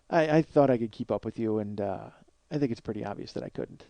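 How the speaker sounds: low, soft, halting confession; sheepish admission. Retold or imitated. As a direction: imitate a sheepish man owning up quietly. Animator's sheepish admission, retold.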